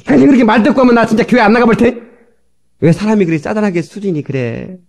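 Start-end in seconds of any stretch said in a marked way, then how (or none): 0.1-2.0 high, loud sulky threat; husband threatening his wife he won't go to church; mock threat. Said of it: "부인한테 협박한다니까?".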